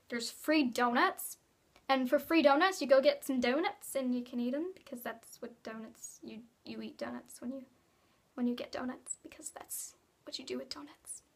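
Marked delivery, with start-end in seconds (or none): 4.7-11.2 whisper. Pretend. Joking donut whisper aside.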